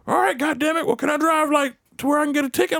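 put-on cranky old driver, high voice; high-pitched comic grumble; Bash. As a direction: imitate a grumbling old man, quick and high. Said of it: "I could just be like".

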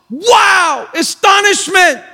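gasp, then high amazed exclamation; high-pitched awe at a miracle; astonishment. Acted. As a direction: gasp and cry out in wonder.

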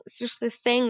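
ordinary delivery

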